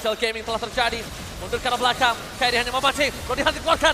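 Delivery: loud hype play-by-play; esports caster. Real, not acted. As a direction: shout the teamfight call. Caster yells live teamfight.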